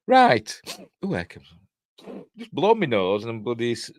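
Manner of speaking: normal talking voice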